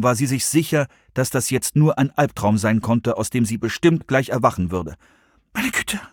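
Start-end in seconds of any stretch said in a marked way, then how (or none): 5.5-6.0 high, soft gasp of shock; small, hushed and high; breathed exclamation. A narrator's reading. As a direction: breathe it out, stunned. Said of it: "hauchte sie".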